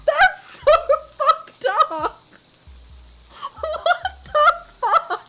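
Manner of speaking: high, loud, laughing outrage; shocked yell. Real, not acted. Shocked yelling at twist.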